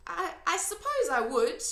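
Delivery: a hint of a young girl asking; young girl Sophie. A narrator's reading. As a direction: speak up as a curious little girl. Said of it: "Sophie said".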